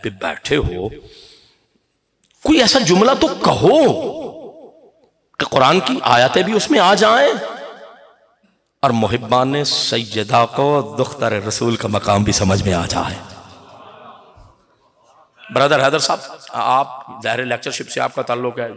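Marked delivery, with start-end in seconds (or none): none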